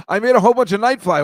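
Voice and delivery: loud, high, fast imagined outburst; mock protest. Host imagining bumper maker's reaction to name change, mock protest. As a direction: blurt it out in acted indignation. Acts out a protest over renaming.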